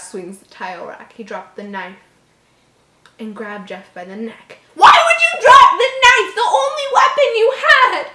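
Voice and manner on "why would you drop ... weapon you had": loud, high disbelieving yell at the story; exasperated shout. Real, not acted. Reader yelling at the dumb character in disbelief.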